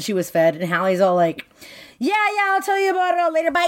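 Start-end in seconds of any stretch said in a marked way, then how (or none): 2.0-3.6 high-pitched fake-breezy goodbye, last word stretched out; mock breezy brush-off. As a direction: hurry them off the phone in a high mock voice. Brushing off caller in retold call.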